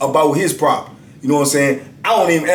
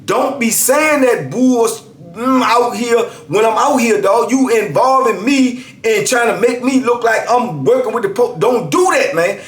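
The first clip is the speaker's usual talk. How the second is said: higher, hard warning; emphatic; 7 semitones higher than usual. Retold or imitated. Narrator warning the inmate at his cell, higher emphatic. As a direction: lay down the law to him. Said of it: "I was emphatic this time".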